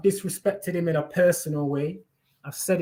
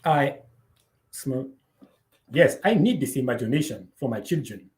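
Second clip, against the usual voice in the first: mock African accent; 2 semitones lower than usual. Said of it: "I'm not African".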